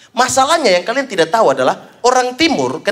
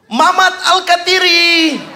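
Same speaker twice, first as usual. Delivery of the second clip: high drawn-out shout of a name; retold distant yell; 11 semitones higher, 3 dB louder, much slower than usual. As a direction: yell it slowly across a long distance. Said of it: "Dia teriak dari ujung sana".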